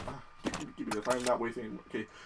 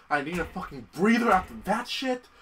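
usual speaking voice

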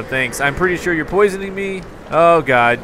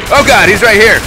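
loud, higher startled shout; alarmed yell; 5 semitones higher, 10 dB louder than usual. Real, not acted. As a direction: yell in sudden panic, then crack up. Sudden threat appears in game.

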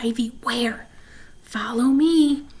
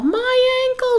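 high, loud fake wail of pain; hammed-up wail; 14 semitones higher, 5 dB louder than usual. Bean faking an injury to her sister, high hammed-up wail. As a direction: overact a sprained ankle. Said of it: "started to wail".

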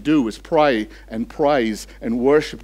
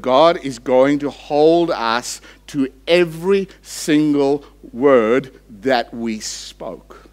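ordinary talk

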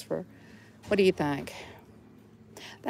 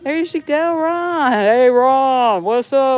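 excited calling out; 6 semitones higher, 9 dB louder, slower than usual. Real, not acted.

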